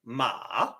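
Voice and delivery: "ma" dipping down and rising again; scooped "ma", down then up; third tone. Shown as a demonstration. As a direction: drop "ma" low, then lift it. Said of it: "and goes back up".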